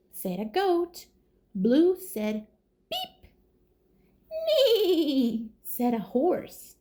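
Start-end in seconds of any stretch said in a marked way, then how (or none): 2.9-3.3 very high, soft toy-horn beep; truck beep. Acted. Reading aloud to kids, Blue's high little beep. 4.5-5.5 high, laughing whinny; horse neigh. Acted. Reading to kids, a giggly horse neigh.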